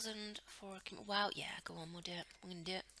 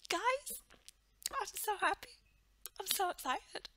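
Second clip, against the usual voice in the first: much higher happy voice; 13 semitones higher, 3 dB louder than usual. Real, not acted. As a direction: gush with joy. Happy squeal.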